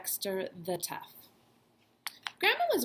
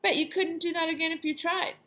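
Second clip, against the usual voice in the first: joking dad; 8 semitones higher, 4 dB louder than usual. Retold or imitated. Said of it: "And Dad would have said".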